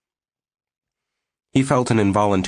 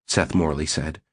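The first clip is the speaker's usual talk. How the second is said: usual speaking voice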